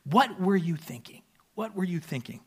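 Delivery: lightly voiced Mary, half-amused reproach; mother scolding her son, understated exasperation; mock exasperated mother. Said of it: "comes out as".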